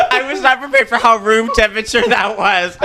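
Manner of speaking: laughing. Real, not acted. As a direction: laugh through it. Laughing about warm sushi.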